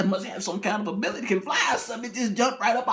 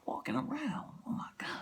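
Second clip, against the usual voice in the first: soft, breathy dread; terrified whisper; 5 semitones higher, 13 dB quieter than usual. Acted. Husband whispers to his wife in terror. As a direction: whisper it in horror.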